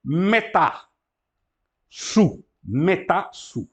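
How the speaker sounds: "Meta, su" split into slow syllables; Greek syllables. Shown as a demonstration. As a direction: sound out each part. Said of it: "Meta é uma preposição". ("meta, su" silabado)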